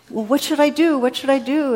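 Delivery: high, loud mock plea; hammed-up frantic begging for instructions; desperate pleading. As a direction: plead upward to God, pitched high. Dangling man pleads with God.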